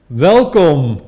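loud drawn-out show host welcome; announcer welcome. Acted. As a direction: boom it like a radio host. Host's big show welcome.

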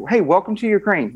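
high, bright-toned mock officer greeting; cheery officer welcome. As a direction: pitch the welcome up high and hearty. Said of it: "He's like".

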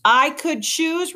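slow, high-pitched, incredulous comeback; indignant retort. A narrator's reading. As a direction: repeat it in rising indignation. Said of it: "retorted Luxa".

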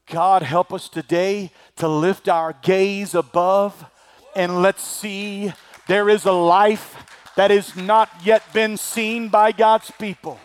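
ordinary talk

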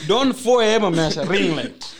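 teasing, laughing and loud; laughing accusation. Real, not acted. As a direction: accuse while cracking up.

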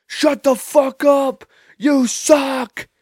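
high, strained mock yell; heckler's yell. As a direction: imitate an irate fan yelling from afar. Imitates fan yelling back at him.

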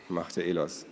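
ordinary delivery

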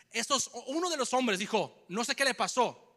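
ordinary talk, nothing marked

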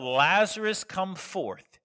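loud command into the tomb; commanding shout. Acted. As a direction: call the name out loud. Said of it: "When Jesus yelled".

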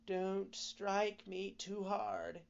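high, slow, pleading little voice; tiny voice begging gently. A narrator's reading. Tiny voice in the log begs the carpenter, high and slow. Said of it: "a very tiny voice begging him gently".

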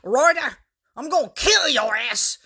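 loud, high strangled yell; furious shout from the hallway. A narrator's reading. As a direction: yell it out, half choked with rage. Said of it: "came a strangled yell".

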